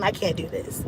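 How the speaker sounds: dropped low and soft, defeated; quiet despair. Retold or imitated. To the girl next to her, quietly defeated. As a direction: sink into a near-mutter of despair. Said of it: "I, look, listen".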